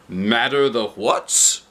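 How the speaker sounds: slow, puzzled question; lightly voiced Topsy-Turvy. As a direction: ask kindly, words back to front.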